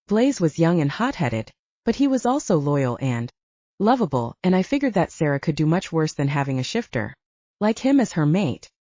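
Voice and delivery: plain reading voice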